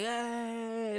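high, excited mock cheer of welcome; cheering for the only audience. Comedians cheering two arrivals, high excited greeting.

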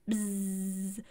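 low sustained buzz; imitated buzzing. Shown as a demonstration. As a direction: hum a long, low bzzz. Imitating werewolves' buzzing aura.

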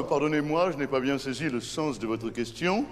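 a hint of a stiff posh Parisian. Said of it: "on dit pas".

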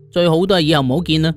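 ordinary delivery

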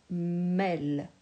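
"Mêle" said slowly with a clear open è; teacher models "Mêle"; modelled syllable. Shown as a demonstration. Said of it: "il y avait juste à rajouter un M devant".

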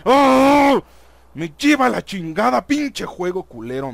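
loud frustrated cursing at the game; angry outburst. Real, not acted. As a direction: let rip at the game. Raging at the game.

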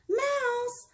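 high calling mom; mom shouting. Acted. Mom calling her daughter during birthday candles, high shout. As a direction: call the child's nickname up high.

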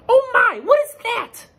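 very high, loud, astonished duck voice; quacking duck. Acted. Duck spotting a strange thing, high excited quack. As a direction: squawk it high like a startled cartoon duck. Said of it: "Duck quacked".

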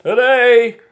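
loud, high, slow speech parody; Barack Obama. Acted. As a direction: proclaim it slowly like a booming orator. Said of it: "Read the joke like Barack Obama".

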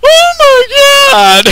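high loud scared scream; real fright, full voice; terrified scream. Real, not acted. Second jump scare.

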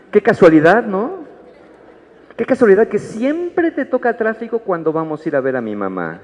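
wife rolling her eyes at the traffic excuse; sarcastic wife. Acted. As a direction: needle him about his convenient traffic. Wife mocks husband's excuse.

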